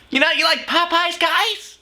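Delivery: very high mock imitation of the woman; mimicking her talk. Host parodying the woman's talk about Popeyes, mocking. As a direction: send her up in a squeaky-high voice. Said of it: "when she talks, she goes like".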